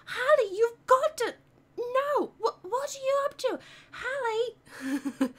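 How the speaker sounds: put-on high, loud British girl voice; urgent, high acted film dialogue; Annie. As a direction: scold a sister in high-pitched mock British. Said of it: "Yeah, The Parent Trap".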